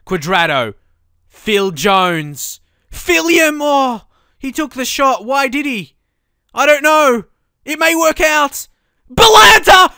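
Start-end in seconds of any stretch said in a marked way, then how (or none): 1.3-9.9 high, loud, laughing goal celebration; ecstatic goal scream. Real, not acted. Gamer celebrating a late goal, screaming with joy. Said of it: "I sounded so weird when he got a goal".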